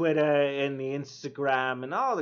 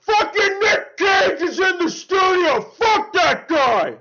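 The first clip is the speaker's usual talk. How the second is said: high, loud, slow hammed-up Nick Cage shout; Nick Cage impression; 13 dB louder, slower than usual. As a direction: blast it out as an over-the-top Nick Cage. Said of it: "let me give you a taste".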